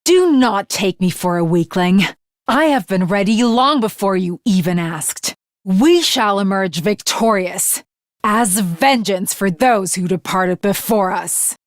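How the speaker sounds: a hint of a stern knight swearing vengeance; lightly voiced resolute warrior. Warrior character, lower voice.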